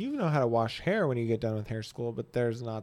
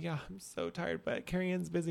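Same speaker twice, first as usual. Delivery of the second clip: softer, weary reply; tired sigh; 3 semitones higher, 10 dB quieter than usual. Retold or imitated. Boss answering the coffee offer, softly and wearily. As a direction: play it drained and sleepy. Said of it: "I'm like".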